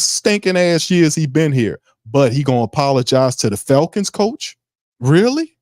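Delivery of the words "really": much higher disbelieving really; incredulous. Real, not acted. Ranting host to viewers, sharply high and incredulous. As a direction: scoff in disbelief.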